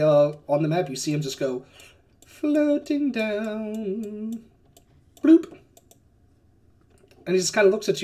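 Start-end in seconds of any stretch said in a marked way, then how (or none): 2.2-4.4 very slow, stretched-out words; drawn-out floating. Acted. GM showing the giant descending on the map, slowly drawn out. 5.2-5.6 high, popped bloop; a quick high cartoon blip; bloop sound effect. GM dropping the giant's token on the map, high bloop.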